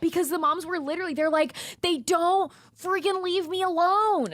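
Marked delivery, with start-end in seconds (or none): 1.8-4.3 slow, high, drawn-out mock complaint; exasperated mom. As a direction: voice a fed-up mother, stretching the words. Voicing moms fed up with kids.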